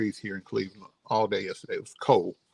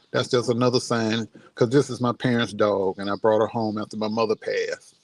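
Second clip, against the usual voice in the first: normal talking voice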